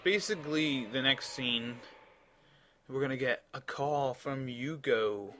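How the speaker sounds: usual speaking voice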